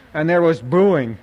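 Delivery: ordinary delivery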